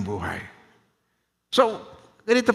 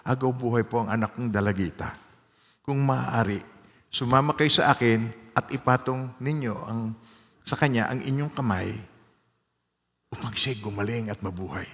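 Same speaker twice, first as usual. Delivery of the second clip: a soft, hushed plea for his dying daughter; pleading father; 6 semitones lower, 6 dB quieter than usual. Retold or imitated. Father begging Jesus to heal his daughter, soft pleading. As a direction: beg quietly, like a desperate father. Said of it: "nagsusumamo siya".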